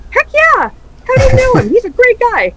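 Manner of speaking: high, loud, thrilled gush of praise; light up like you love the guy; gushing excitement. Retold or imitated. Retelling radio people praising Spider, excited and loud.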